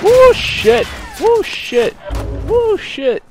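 high, loud whoop; excited yell. Real, not acted. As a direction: whoop it out in triumph. Excited yell after kill.

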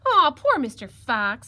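drawn-out, loud piglet sigh of pity; sighed sympathy. Acted. Piglet looks at the exhausted fox, sighing pityingly. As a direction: sigh it slowly with mock-innocent pity. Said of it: "sighed the little piglet".

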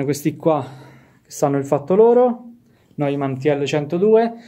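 ordinary talk, nothing marked

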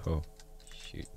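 ordinary talk

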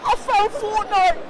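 high, loud triumphant announcement; excited. Pretend. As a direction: shout the discovery like a gamer kid.

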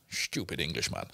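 lightly put-on Dutch accent, low and scornful; mock a sneering Dutch ticket collector. Ticket collector scoffing at the English tourist, mock Dutch accent. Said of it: "In a Dutch accent".

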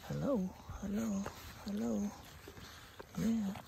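greeting newborn puppies, high and tender; cooing at puppies. Real, not acted. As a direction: coo hello to the pups.

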